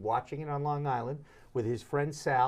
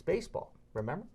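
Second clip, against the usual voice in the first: normal talking voice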